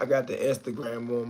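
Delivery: normal talking voice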